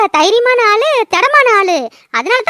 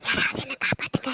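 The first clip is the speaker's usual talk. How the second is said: whispered, low call for help; soft mock plea; 9 semitones lower, 15 dB quieter than usual. Shown as a demonstration. Woman showing friend how feeble a gentle plea sounds, whispered. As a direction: say it uselessly softly, under the breath. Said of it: "அப்படின்னு அசாம ஓடி சொல்ல முடியும்?".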